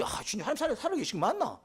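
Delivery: high-pitched mock prayer full of doubt; hammed-up doubting plea to the Lord. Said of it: "이래 빈다, 이 말이죠".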